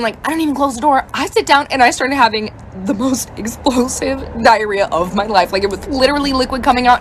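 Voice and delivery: ordinary talk, nothing marked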